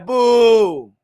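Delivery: long, loud mock boo. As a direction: boo like an unimpressed crowd. Mimics fans booing low turnout.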